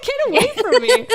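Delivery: high, loud, laughing brush-off; brushing off the liar, laughing loud; laughing mock shout. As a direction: shoo him off through laughter.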